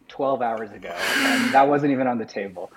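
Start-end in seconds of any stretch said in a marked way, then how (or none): none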